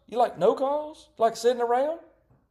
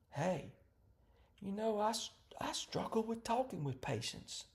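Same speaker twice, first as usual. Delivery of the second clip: quiet admission; 12 dB quieter than usual. Pretend. Partner admits weakness quietly.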